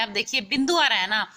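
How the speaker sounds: normal talking voice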